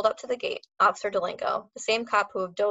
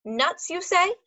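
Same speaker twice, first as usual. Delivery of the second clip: put-on dog trainer in a German accent, agitated; a heavy German accent, slowing on the warning; 9 semitones higher, 3 dB louder, much slower than usual. A narrator's reading. Dog trainer warning about snakes, German accent.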